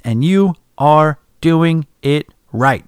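each word separated by a pause, slow. Shown as a demonstration. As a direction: read it like five one-word sentences with full stops. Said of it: "Separated by all periods each word".

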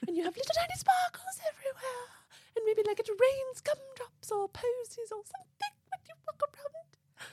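very high floaty fairy voice. Shown as a demonstration. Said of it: "the fairy voice".

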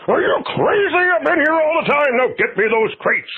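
a huffy customer barking orders; Mister McDougal impression. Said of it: "Mister McDougal from Abbott and Costello Meet Frankenstein".

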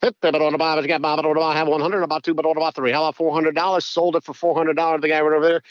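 rapid-fire auctioneer patter on one level pitch. Shown as a demonstration. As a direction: rattle off bids like an auctioneer. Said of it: "I do some auctioneering on the side".

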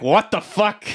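a stunned, high what-the-fuck; baffled outburst. Retold or imitated. Crew reacting to Harry manifesting the book, stunned and high.

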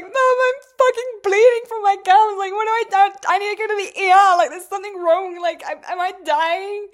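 high, loud, frantic pleading; retelling the panic in a shrill, rushed rush of questions; panicked. Retold or imitated. On the phone to mum, bleeding gums, frantic high panic.

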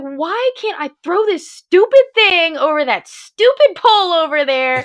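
exasperated at the stubborn kettlebell; exasperated outburst. Retold or imitated. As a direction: racer failing the kettlebell throw, exasperated. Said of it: "I just started getting so mad".

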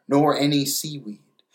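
plain reading voice

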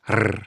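a rolled Rrr. Shown as a demonstration. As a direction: trill the Rrr. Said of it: "You gotta slightly roll the R".

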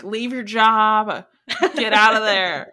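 loud, slow push to quit; emphatic urging. Retold or imitated. Host tells a friend to quit, loud and slow. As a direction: urge your friend to leave, loudly and slowly. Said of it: "I'm like".

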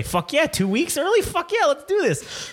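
high, excited whoop; hyped cheer. Retold or imitated. Friends cheering the early trip home, excited and high.

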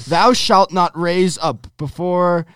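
put-on high, loud, slow King James accent, like a pompous preacher. Reading Leviticus aloud to podcast friends, in a hammed-up King James accent. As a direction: boom out the verse in a mock King James accent. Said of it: "King James accent".

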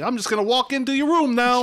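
oblivious dad, voiced well up high; breezy, clueless announcement, pitched high; mock Tony. Mocking the clueless dad barging in, pitched high. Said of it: "And he's like".

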